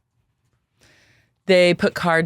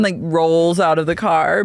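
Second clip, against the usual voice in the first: usual speaking voice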